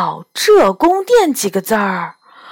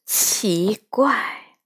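soft, under-the-breath puzzlement; puzzled mutter; 2 semitones lower, 8 dB quieter than usual. A narrator's reading. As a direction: mutter it to yourself, much quieter. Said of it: "他嘟囔着走近狐狸".

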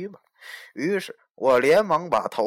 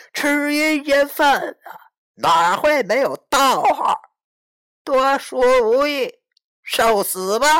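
slow, much higher and louder ghostly threat; menacing ghost threat; 9 semitones higher, 8 dB louder, slower than usual. Acted. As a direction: roar it like a vengeful ghost lord. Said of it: "冷笑了一下".

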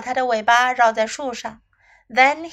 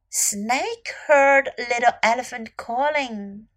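ordinary delivery, nothing marked

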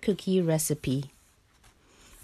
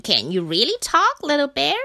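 louder, eager question rising high; eager asking; 6 semitones higher, 5 dB louder than usual. A narrator's reading. The animals crowd round to question Bear, eager. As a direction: voice a crowd's keen curiosity. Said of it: "they all asked together".

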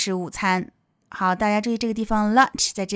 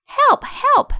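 very high, louder acted plea; cry for help; 5 dB louder than usual. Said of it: "是寻求帮助的声音".

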